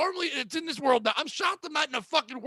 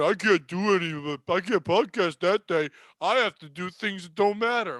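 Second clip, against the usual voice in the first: mocking imitation; 5 semitones lower than usual. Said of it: "That's when you guys start going".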